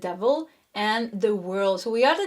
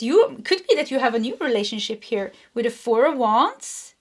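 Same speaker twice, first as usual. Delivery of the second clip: ordinary delivery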